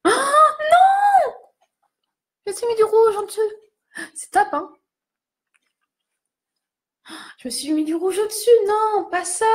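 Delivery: high-pitched cry of dismay; dismayed wail. Real, not acted. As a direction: wail in dismay at your own slip. Dismay at lipstick smeared underneath. (plainte aiguë et catastrophée)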